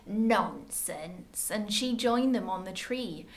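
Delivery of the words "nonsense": low, dismissive scold. A narrator's reading. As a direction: brush it off like a stern mother. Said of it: "Their mother scolded".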